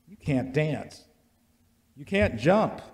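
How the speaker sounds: usual speaking voice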